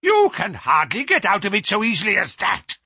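high, loud, threatening outburst; furious passion. A narrator's reading. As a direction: rage at them as if blocking the door. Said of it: "shook his two hands at us with furious passion".

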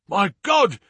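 high acted cry of alarm; high-pitched horror; horrified cry. A narrator's reading. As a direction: exclaim in alarm, voice leaping up. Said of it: "he cried".

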